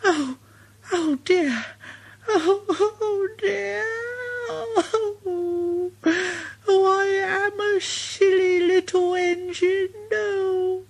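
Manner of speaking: a tiny engine wailing at its own silliness; Thomas crying. Acted. Thomas cries in the hole.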